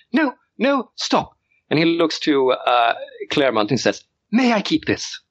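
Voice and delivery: put-on high, breathless boy giving orders; excited child lord. Young lord calls his butler, high and eager. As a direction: demand it like a thrilled little aristocrat.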